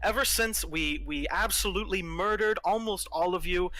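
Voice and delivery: lavish sarcastic praise on the rebel leader; gleefully condescending villain. Acted.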